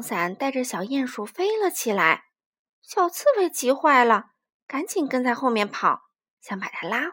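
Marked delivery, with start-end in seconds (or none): none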